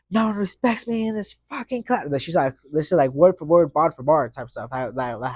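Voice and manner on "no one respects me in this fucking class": higher, imitating a teacher's tearful rant; mock her rant, high and strained. Teacher ranting at her class, imitated upset. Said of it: "she goes on a rant".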